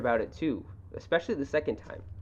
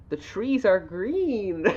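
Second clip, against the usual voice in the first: mildly hammed-up, drawn-out and higher; mocking quote; 6 semitones higher, 3 dB louder, much slower than usual. Co-host quoting a fantasy world's basic description, mocking. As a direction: read it slowly as if it's silly.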